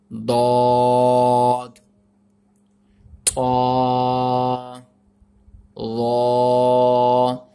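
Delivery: slow teaching recitation of letters. Shown as a demonstration. Teacher recites letters one by one to learners.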